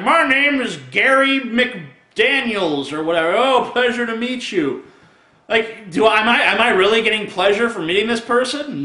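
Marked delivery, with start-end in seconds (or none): 0.0-2.9 high, slow, drawn-out self-introduction; mock introduction. Nobody introducing himself to someone, mock introduction. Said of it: "I walk up to someone like". 3.4-4.9 higher, put-on polite delight; mock pleased greeting. Greeting someone who is not famous, mock pleasure. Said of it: "when they say it to someone".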